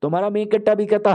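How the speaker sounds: usual speaking voice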